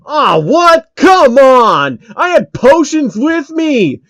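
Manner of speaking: high, loud, outraged yelling; gamer rage. Acted. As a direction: yell at the game for cheating you. Player loses to early monster.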